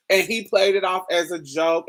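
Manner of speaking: ordinary talk, nothing marked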